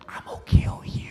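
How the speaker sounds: whispered mock death threat; acted hushed menace; menacing whisper. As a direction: whisper it as a scary inmate staring someone down. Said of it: "staring right in front of me like this".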